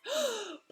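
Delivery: sharp high gasp of shock; sudden gasp. Acted. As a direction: gasp in alarm. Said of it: "gave a sudden gasp".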